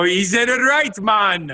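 high-pitched mock Jamaican accent. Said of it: "say it like a Jamaican".

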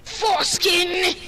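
slow, stretched battle yell; drawn-out shout. Acted. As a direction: bellow it like a war cry. In-game battle cry.

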